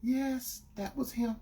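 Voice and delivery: retold girlfriend. Girlfriend admitting who it was, imitated meekly. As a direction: imitate her quietly owning up. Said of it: "She said".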